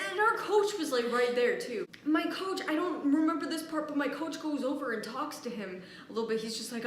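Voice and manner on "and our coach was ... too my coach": ordinary talk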